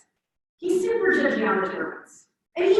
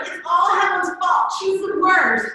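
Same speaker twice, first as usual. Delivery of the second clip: higher and louder, a hammed-up angry shout; blaming outburst; 7 semitones higher, 5 dB louder than usual. A student in class blaming Helen, mock angry shout. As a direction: throw all the blame on her, mock-furious. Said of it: "And he's like".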